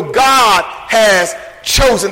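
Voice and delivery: slow, weighted "God has chosen"; slow emphasis on "God"; emphatic. Shown as a demonstration. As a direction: land each word of "God has chosen" slowly, stressing "God". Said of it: "That's the emphasis".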